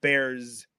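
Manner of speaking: BEARS ending in voiced Z; Z plural. Shown as a demonstration. Showing how most English speakers say it, BEARS with Z. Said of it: "at the end of bears as a Z sound".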